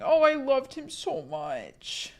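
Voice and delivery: high, slow, tearful; crying. Real, not acted. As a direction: speak through real tears about the lost dog. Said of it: "I'm actually crying".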